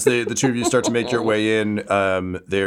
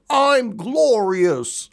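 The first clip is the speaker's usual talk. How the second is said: stretched, much higher self-proclaimed boast; slow glorious boast; 12 semitones higher, much slower than usual. Acted. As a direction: savor every syllable of your own glory. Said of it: "your voice being like".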